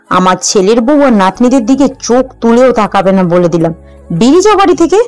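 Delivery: put-on voice of a scolding aunt; thundering scolding. Husband's aunt turns on the wife, thundering scolding. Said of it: "বর্জ্যের মতো গর্জে ওঠে".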